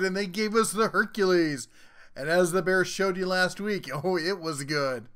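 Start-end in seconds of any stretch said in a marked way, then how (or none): none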